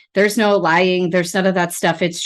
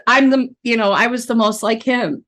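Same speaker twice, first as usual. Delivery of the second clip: ordinary delivery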